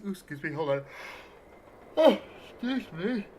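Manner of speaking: talking through a big yawn. Real, not acted. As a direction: yawn while saying it. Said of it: "big yawn".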